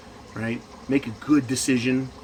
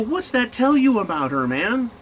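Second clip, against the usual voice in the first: retold challenge; 8 semitones higher than usual. Acted exchange challenging his friend.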